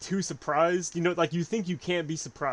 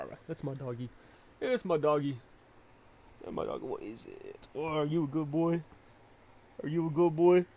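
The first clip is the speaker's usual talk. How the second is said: talking to a dog; 6 dB quieter than usual. Real, not acted. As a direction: coo at the dog gently. Suddenly talking to his dog.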